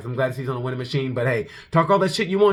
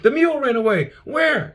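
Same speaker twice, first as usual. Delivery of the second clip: high-pitched little kid impression; high, stiff-necked kid voice; Mickey from The Little Rascals; 11 semitones higher than usual. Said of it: "He was like Mickey from the Little Rascals".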